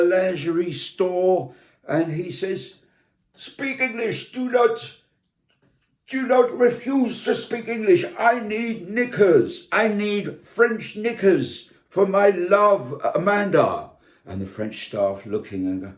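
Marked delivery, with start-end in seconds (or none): 3.3-13.9 hammed-up Brit insisting on English; demanding Englishman. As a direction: bark orders at the French shop staff. Brian demanding in French shop.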